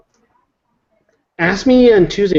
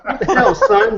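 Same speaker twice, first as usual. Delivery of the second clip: laughing. Real, not acted. Teasing friend, laughing.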